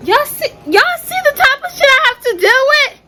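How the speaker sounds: loud, high, fed-up complaint; exasperated outburst. Real, not acted. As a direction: vent at the camera.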